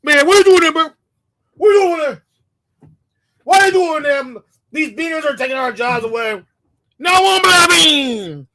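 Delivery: hammed-up parody of an angry complaint; mocking imitation. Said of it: "That's how they talk".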